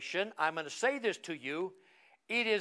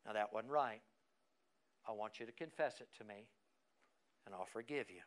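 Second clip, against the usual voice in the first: much lower and much softer, tender; gentle voice of conviction; 10 semitones lower, 11 dB quieter, faster than usual. Acted. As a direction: speak as a loving father forgiving a child. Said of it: "Here's conviction".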